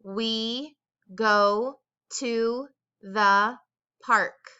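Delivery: very slow, word by word; slow dictation. Shown as a demonstration. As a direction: spell it out slowly so kids can follow. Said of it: "You say it at home".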